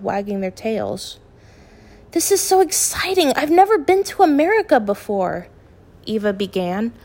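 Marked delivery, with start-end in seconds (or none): 2.1-5.5 excited. A narrator's reading. Eva thrilled about moving.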